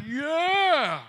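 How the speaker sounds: high, loud pretend war cry; mock battle yell. Preacher playing a believer drawing his sword, loud pretend yell. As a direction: shout it like swinging a sword.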